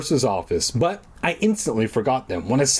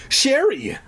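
cried out; 12 semitones higher than usual. A narrator's reading. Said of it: "I cried".

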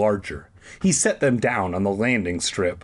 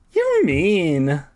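Carolyn voice; 8 semitones higher, 4 dB louder than usual. A narrator's reading. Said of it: "she told Ernesto".

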